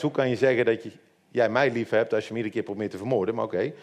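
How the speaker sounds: ordinary talk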